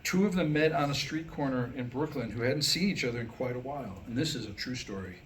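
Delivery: ordinary talk, nothing marked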